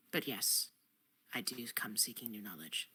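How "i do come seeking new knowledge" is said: hushed unvoiced whisper. Character whispers to the spider queen. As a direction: whisper it like a secret.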